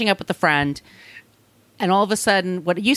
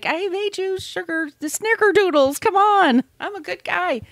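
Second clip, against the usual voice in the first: high, sugary fake-nice voice; a man at her door pushing his cookies, high and sugary; high mock sweet boyfriend; 13 semitones higher than usual. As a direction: play the creep sweet-talking at the door. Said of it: "And he's like".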